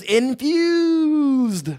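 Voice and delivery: "infused" held long and high; drawn-out INFUSED. Pretend. Host revealing the product, "infused" hugely drawn out. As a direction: stretch out the word infused like a showman. Hyping the product, word stretched.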